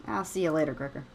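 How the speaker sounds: ordinary talk, nothing marked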